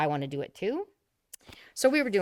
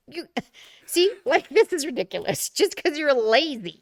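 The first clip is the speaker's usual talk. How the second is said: louder and higher, teasing exasperation with laughs; exasperated retort; 8 semitones higher, 7 dB louder than usual. Retold or imitated. Telling her brother off for not learning Italian, laughing exasperation. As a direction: scold him playfully, half laughing.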